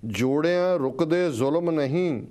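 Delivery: slow, measured verse with swelling, falling line ends; stirring, drawn-out poetry reading; poem recitation. Real, not acted. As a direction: declaim each line deliberately, pausing between phrases. Recites a listener's poem aloud.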